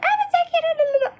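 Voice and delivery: put-on very high, squealing little girl babble; mock children gushing nonstop; squeaky kid chatter. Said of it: "they're like".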